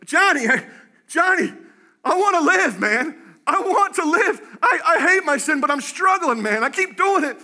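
high, loud cry of a struggling believer; desperate plea. Acted. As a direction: plead at the top of your voice for help. Acts struggling congregant's anguished plea.

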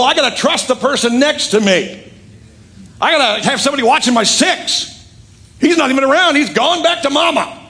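rant, hammed up, against giving him a chance; hammed-up Paul objecting. Paul refusing to take John Mark back, mock indignant. Voicing Paul's angry objection.

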